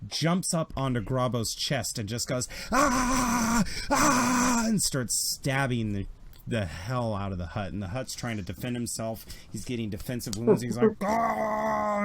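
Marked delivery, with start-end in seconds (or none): 2.7-4.6 long, high, loud yell; attack scream. Acted. Senator leaps on the Hutt with a shank, long attack scream. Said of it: "he's screaming". 11.0-12.0 long, high-pitched wail; mock scream. Hutt screaming while being stabbed, long high mock scream. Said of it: "and he's screaming".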